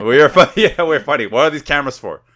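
loud, high, laughing play-acting; mock shouted protest. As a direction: blurt it out loudly through laughter. Acting out imagined row with authorities.

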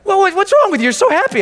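high, loud mock complaint; hammed-up high-pitched reproach; mimicked baffled friend. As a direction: squeal it like an appalled buddy.

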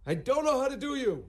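high, loud frantic panic; frantic Larry. Retold or imitated. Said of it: "frantic, going".

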